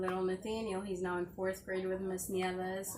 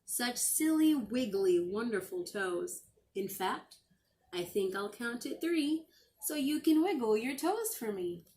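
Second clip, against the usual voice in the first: higher and louder, teasing fun; playful storybook lilt; 4 semitones higher, 6 dB louder than usual. Acted. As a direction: coax the kids to wiggle their toes. Playful lines about wiggling toes.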